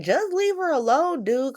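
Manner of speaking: high-pitched mock plea; protesting. Cody telling Drew to back off, protesting. Acted Cody pleading for Willow.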